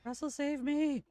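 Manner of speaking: high, loud, slow desperate cry; breathless fright. Real, not acted. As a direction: scream for rescue, terrified. Chased, screaming for companion.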